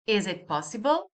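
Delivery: "Is it possible" rising at the end; question intonation. Shown as a demonstration. Teacher giving an example question, rising tune on "possible". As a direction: lift the last word "possible" into a question. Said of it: "I just raise the intonation".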